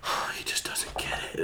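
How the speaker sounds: hushed retold aside to oneself; very soft, breath-only lament; whispered dismay. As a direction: whisper it, let down and baffled. Retold private disappointment while tripping.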